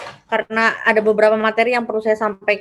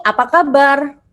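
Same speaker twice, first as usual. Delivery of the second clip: "Apa kabar" lifted higher and louder as a question; rising-falling intonation; 4 semitones higher, 5 dB louder than usual. Shown as a demonstration. Teacher showing a student what intonation means, emphatic questioning tune. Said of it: "akhir turun dan naik itu contohnya gini".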